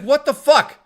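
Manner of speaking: retold disbelief, high and loud; mock outraged shock. [name] reacts to her story, mock shocked. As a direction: imitate a friend's loud shock.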